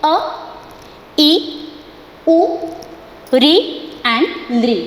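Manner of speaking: अ, ई, उ, री and लृ each said short, with pauses between; short vowel drill. Shown as a demonstration. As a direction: recite the short vowels one by one, very slowly. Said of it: "हम इसका pronunciation देखेंगे".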